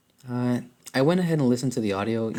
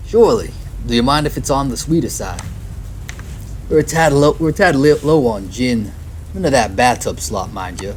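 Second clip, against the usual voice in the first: bartender voice; 3 semitones higher, 6 dB louder than usual. Acted.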